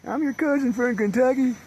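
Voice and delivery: high, put-on cousin greeting; high hammed-up hello; calling to strangers. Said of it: "go over there and say".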